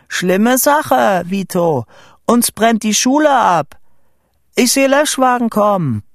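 high, loud alarmed report; shouting. A narrator's reading. Boy telling Vito the school burns, shouting. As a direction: shout the emergency to a friend below. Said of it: "schrie ich ins Telefon".

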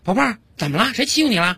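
high, cooing mother voice; acted motherly fussing; concerned mother. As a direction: anxiously soothe a crying child. Mother asks her crying baby.

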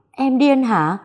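high, loud outburst; hammed-up yell of disbelief. Husband yells at wife over hospital handover, loud outburst. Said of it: "Anh Hoàng vừa gào lên".

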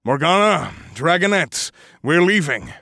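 dragon's voice bellow. A narrator's reading. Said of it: "bellowed with my dragon's voice".

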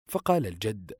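usual speaking voice

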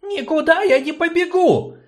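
put-on high, loud defiant girl; defiant retort. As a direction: snap back, refusing outright. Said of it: "отрезала девчонка".

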